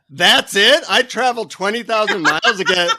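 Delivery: high, loud acted protest; indignant disbelief. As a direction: protest like a cheated customer, playing it for laughs. Acted outrage at the guru.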